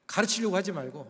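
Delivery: usual speaking voice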